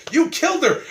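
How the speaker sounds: high-pitched accusing yell; mock yell. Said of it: "yell, like".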